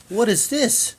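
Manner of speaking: high, puzzled what-is-this; mock wife baffled by the gift; wife's confused question. Wife shown the turtle, puzzled high question. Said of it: "she's like".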